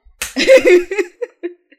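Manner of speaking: high burst of laughter; loud laugh. Real, not acted. Laughs at the joke about her first child. (carcajada aguda)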